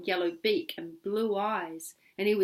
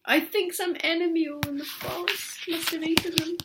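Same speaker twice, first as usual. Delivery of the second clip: worried daddy; 9 semitones higher than usual. Acted. Daddy voiced in the story.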